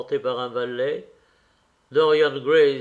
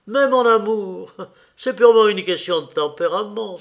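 higher, louder continuation of an exclamation; exclaiming; 5 semitones higher, 6 dB louder than usual. A narrator's reading. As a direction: exclaim emphatically in a raised voice. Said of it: "s'écria Lord Henry". (exclamation appuyée, plus haute et plus forte)

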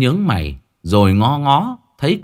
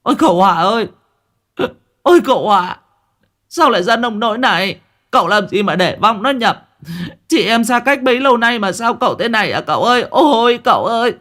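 wailing lament; 8 semitones higher than usual. Acted. Sister-in-law wails over her brother, a high keening lament. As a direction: wail over him like a mourner at a funeral. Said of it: "tiếng khóc lóc ỉ ôi".

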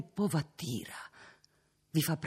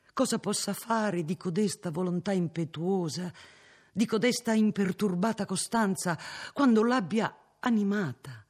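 impassioned; 6 semitones higher, 3 dB louder than usual. Acted. Cardinal's impassioned appeal.

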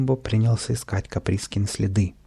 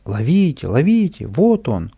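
crowd shouting; 3 semitones higher, 3 dB louder than usual. Acted. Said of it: "И все кричали".

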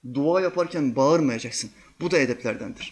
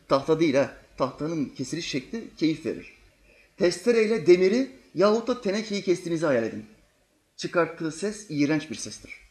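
ordinary talk, nothing marked

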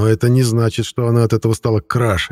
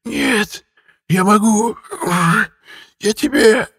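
high, strangled pleading; broken, squeezed high voice; choking wheeze; 12 semitones higher, slower than usual. A narrator's reading. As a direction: gasp out fragments while being throttled. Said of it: "вскоре сменились хрипом".

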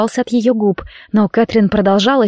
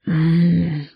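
deep satisfied hum, lower; low purring hum; 4 semitones lower, 3 dB quieter than usual. A narrator's reading. Demon purring while caressing Catherine, low hum. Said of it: "промурчал он".

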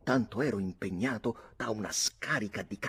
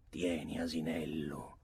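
soft, low aside; calm gruff offer; 3 semitones lower, 6 dB quieter than usual. A narrator's reading. Ulick pushes the bottle to his friend, calm and low. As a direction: say it quietly, settling down.

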